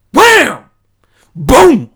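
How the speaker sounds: a sudden booming wham, then boom, shouted high; loud imitated impact. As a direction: blast the impact sounds out like the quake hitting. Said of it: "otherwise I'll blast your ears off".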